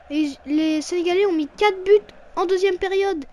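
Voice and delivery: ordinary talk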